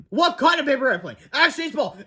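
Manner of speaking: high-pitched panicky asking; frantic question. A narrator's reading. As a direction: ask it desperately mid-fall. Panicked question while falling.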